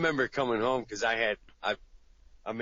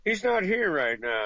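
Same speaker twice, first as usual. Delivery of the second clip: higher, a bit louder, stress on not; fake not-home reply; 9 semitones higher, 4 dB louder than usual. Guy dodging a call, faking not-home voice. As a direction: pretend to be someone else saying he's out. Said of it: "you're like".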